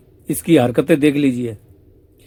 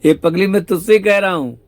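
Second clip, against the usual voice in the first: higher, louder teasing reprimand as Shyam Sundar; playful scolding; 5 semitones higher, 5 dB louder than usual. Acted. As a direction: scold her fondly, voice raised. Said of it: "Shyam सुंदर कह रहे". (ऊँची, तेज़ आवाज़ में प्यार भरी डाँट)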